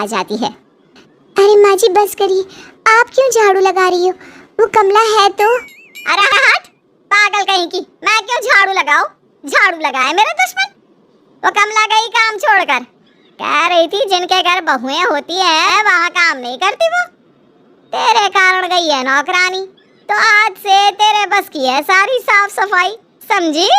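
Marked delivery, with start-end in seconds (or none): 1.4-4.1 put-on young daughter-in-law. Daughter-in-law greets mother-in-law. 4.6-23.7 put-on higher, taunting old mother-in-law voice; scolding mother-in-law. Mother-in-law scolds her daughter-in-law over the broom, taunting voice.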